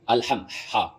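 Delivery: "الحم" with ح from mid-throat, then the letter alone; correct throat ح. Shown as a demonstration. Teacher models the first key sound, correct throat ح. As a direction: isolate the breathed pharyngeal ح of "الحم". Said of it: "مخرج کے ساتھ".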